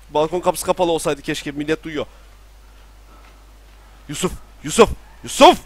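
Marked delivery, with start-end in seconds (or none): 4.1-5.6 rising, louder urgent calls; urgent calling. Real, not acted. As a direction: shout the name, more desperate each time. Karakterine bağırıyor. (telaşla seslenme)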